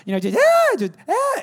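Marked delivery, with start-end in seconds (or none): 0.4-0.8 very high, loud mock shriek; short banshee shriek impression; imitated child's shriek. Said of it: "it kinda sounds like that". 1.1-1.4 very high short mock shriek; banshee-like yelp impression; imitated child's scream. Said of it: "and he screams".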